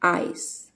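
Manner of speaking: ഐസ് said with a full "ai" glide; ai sound. Shown as a demonstration. Teacher showing pupils the ai sound in ഐസ്, carefully. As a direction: open ഐസ് wide on "ai". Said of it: "ഇവിടെ ഐ".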